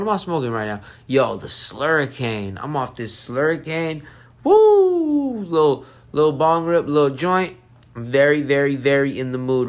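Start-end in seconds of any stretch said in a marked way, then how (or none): 4.4-5.4 loud high "woo"; high-pitched, drawn-out woo; whoop. Real, not acted. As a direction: let out a long high whoop. Reacts to being high on Slurricane.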